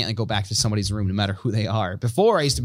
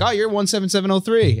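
quick, higher-pitched bartender quote; rattled-off number; 8 semitones higher, 3 dB louder than usual. Retold or imitated. Comic imitating a bartender who knows his card number, rattled off. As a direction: rattle off the number like a familiar routine. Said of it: "You said that so quickly".

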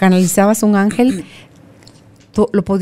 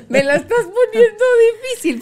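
laughing mock plea; 13 semitones higher than usual. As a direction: plead jokingly while laughing. Ruego fingido entre risas. (queja fingida entre risas)

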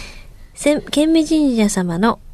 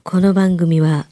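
ordinary delivery

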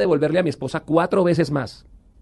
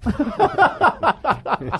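big laugh; 11 semitones higher than usual. Real, not acted. Laughs at own story.

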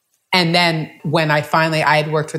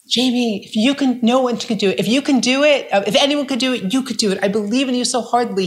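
higher, eager encouraging landlord voice; imitating the landlord; 7 semitones higher than usual. Landlord urging her to stay, higher and eager. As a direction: pitch it up like the pleading landlord.

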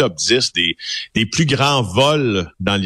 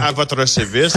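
lightly voiced police officer; a hint of a stiff constable; mock constable voice; 3 semitones higher than usual. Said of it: "comme disait R B O".

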